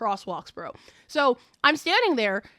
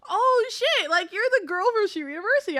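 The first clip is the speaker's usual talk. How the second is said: high, rushed burst of recognition; surprised recognition; 7 semitones higher than usual. Retold or imitated. Reality star recognizing the streamer, imitated surprise. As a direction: imitate someone suddenly placing a familiar face. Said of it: "he was like".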